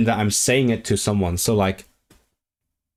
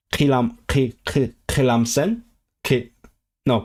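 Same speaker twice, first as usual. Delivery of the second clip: normal talking voice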